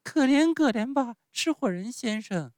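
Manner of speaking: put-on very high puppet-child voice; pleading Pinocchio. A narrator's reading. Pinocchio begs the showman for pity, high pleading. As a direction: beg for mercy in a piping voice. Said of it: "开始哀求他说".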